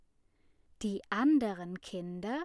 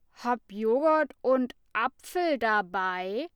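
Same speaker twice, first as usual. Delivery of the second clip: booming; 2 semitones higher, 7 dB louder than usual. A narrator's reading. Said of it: "tönte Lasse".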